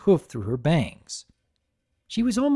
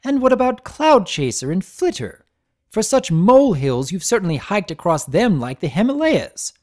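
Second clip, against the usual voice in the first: higher, louder, cutting accusation; glaring accusation; 6 semitones higher, 6 dB louder than usual. A narrator's reading. Blossomforth confronts Thunderlane, loud and angry. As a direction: throw his flings back at him. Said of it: "as she glared and said".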